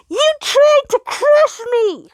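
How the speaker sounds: high, loud, slow accusing yell; the sun yelling into his pillow at Jupiter. Acted. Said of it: "he yelled into his pillow".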